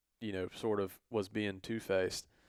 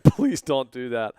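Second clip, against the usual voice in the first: louder, slow, begging her not to; pleading; 4 semitones higher, 12 dB louder, slower than usual. Retold or imitated. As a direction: plead urgently, drawn out. Begging the mom, retold.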